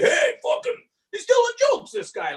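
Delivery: very high mocking heckler voice; heckling crowd. Rowdy bar crowd heckling a comic, squeaky mock jeer. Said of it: "they're like".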